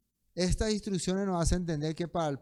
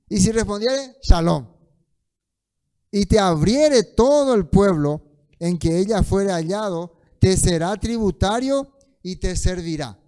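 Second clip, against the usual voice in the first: very loud, raised-pitch verse proclamation; scripture reading; 4 semitones higher, 11 dB louder than usual. Acted. Lee el versículo en voz alta. (proclamación bíblica a plena voz)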